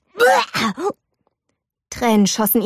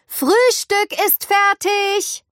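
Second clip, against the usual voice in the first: high, slow sing-song call up the stairs; 11 semitones higher, much slower than usual. A narrator's reading. Mother calls breakfast to her daughter, high sing-song call. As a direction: stretch it out like a cheerful mom calling.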